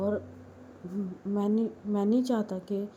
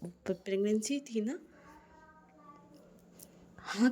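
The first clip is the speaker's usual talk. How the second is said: ordinary delivery, nothing marked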